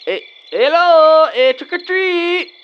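loud, high sing-song call, drawn out slowly; calling at the door. Acted. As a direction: stretch each word out like calling up to a window.

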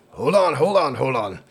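urgent shout to stop. Acted. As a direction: call out to hold them back. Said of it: "he's saying".

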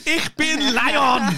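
loud, high, drawn-out mock German; hammed-up German declaration, dragged out high; mock German accent. Podcaster playing a cousin's German lion toy, mock German accent. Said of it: "Ein, zwei, drei".

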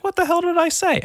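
squeaky high mock bewilderment; indignant younger self. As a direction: protest it in a very high pitch. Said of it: "And I was like".

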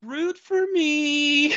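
put-on high, slow girlish falsetto; a man playing a sweet girl; man doing a woman's voice. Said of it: "can you do an impression of Girl Kirito?".

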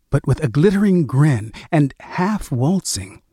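plain reading voice

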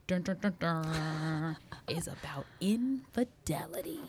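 slow suspense drumroll into whisper; dramatic reveal. Pretend. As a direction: tease the scary topic.

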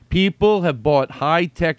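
ordinary delivery

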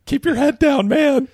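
hushed dread building to high squeal; mock panic. Viewer dreading the beatdown, mock panic rising. Acts out anxious viewer watching fight.

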